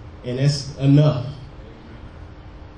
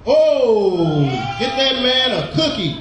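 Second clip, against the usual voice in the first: excited shout; 7 semitones higher, slower than usual. Real, not acted. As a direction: cheer the answer like a game show host. Congregant answers, preacher cheers.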